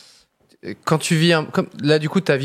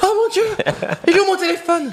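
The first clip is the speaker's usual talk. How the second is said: starstruck panic; faster than usual. Pretend. Fan spots Zac Efron, panics.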